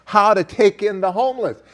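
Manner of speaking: rising fervent sermon voice; preaching. Real, not acted. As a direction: build it like a pulpit sermon. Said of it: "Do I sound like a preacher there?".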